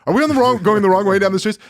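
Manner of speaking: high, loud panicked questions. Retold or imitated. Scared passenger asking the Uber driver, high loud panic. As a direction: blurt it out in rising panic. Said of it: "I immediately start freaking out".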